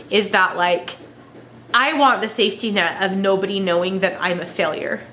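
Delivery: ordinary talk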